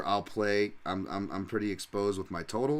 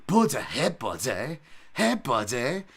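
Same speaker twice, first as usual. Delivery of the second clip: higher, louder British fighter voice; Michael Bisping; 4 semitones higher, 4 dB louder, slower than usual. Retold or imitated. Streamer imitates the commentator cheering a fighter, loud British voice. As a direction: play the Brit commentator, punchy and loud. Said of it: "Michael Bisping impression".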